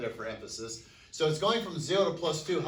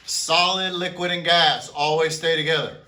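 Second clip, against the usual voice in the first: shouted for emphasis; 2 semitones higher, 10 dB louder than usual. Shown as a demonstration. Teacher shouting the rule for one student, Hilti. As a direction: belt it out to drive the rule home. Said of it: "just for emphasis".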